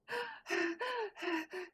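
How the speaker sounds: sudden high gasp; sharp gasp. Real, not acted. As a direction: gasp in surprise. Surprised gasp while gaming.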